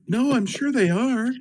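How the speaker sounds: very high, drawn-out mock protest; hammed-up high-pitched insistence; squeaky protest. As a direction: plead in a comic falsetto. Comic acted reply about papers.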